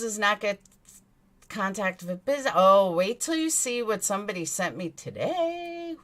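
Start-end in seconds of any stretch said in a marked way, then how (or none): none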